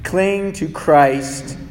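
quiet, slow comfort over pain; soft tender preaching. Acted. Pastor comforting his grieving church, soft and slow.

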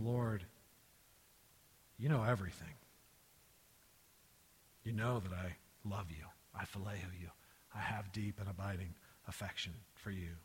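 low, soft, halting confession; subdued, grieved plea with long pauses. Acted. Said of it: "And Peter is grieved".

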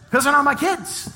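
high-pitched, exultant punchline; gleeful gloating. Acted. Husband relieved the screaming kids aren't his, gleefully high. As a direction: crow with relief at being off the hook.

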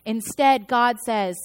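ordinary talk

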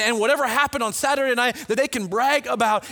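ordinary talk, nothing marked

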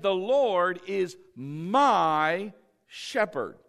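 slow, loud, with MY stretched out; stress on MY. Shown as a demonstration. Preacher showing whose shepherd it is, emphatic drawn-out MY. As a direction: hold "my" long, then land "shepherd". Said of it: "He's not even thinking about someone else".

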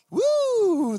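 high, loud joyful whoop; ecstatic whoop. Retold or imitated. Man praying in tongues outside house, loud ecstatic whoop. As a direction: let out a wild spirit-filled woo.

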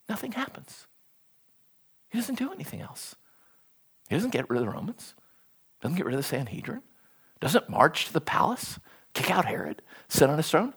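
very soft, anticlimactic listing; hushed preaching. Acted. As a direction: drop right down and let the letdown sink in. Preacher deflates the expectation, very softly.

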